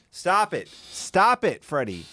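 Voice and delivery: high-pitched, emphatic telling-off; high, insistent reprimand; exasperated scolding. Real, not acted. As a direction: cut him off in exasperation. Scolding the man in video.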